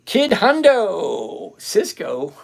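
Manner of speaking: loud, high, drawn-out call of the name. Real, not acted. Streamer greets a viewer in chat, loud drawn-out call. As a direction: stretch the name out big like a ring announcer.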